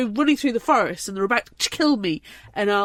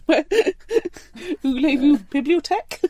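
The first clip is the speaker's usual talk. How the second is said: mock French tourist; 5 semitones higher, much slower than usual. Pretending to be a tourist.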